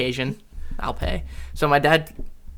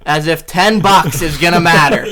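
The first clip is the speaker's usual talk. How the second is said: laughing scoff; 3 semitones higher, 8 dB louder than usual. Retold or imitated. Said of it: "I said".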